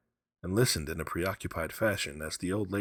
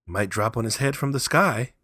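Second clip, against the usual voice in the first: frivolous; 5 semitones higher, 7 dB louder than usual. A narrator's reading. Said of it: "said frivolous Herbert".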